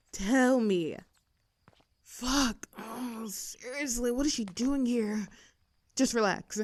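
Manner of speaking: ordinary talk, nothing marked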